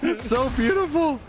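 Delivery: high-pitched hammed-up weepy voice; squeaky mock sob through a laugh; mock crying. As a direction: imitate a man blubbering helplessly. Joins in as crying dad.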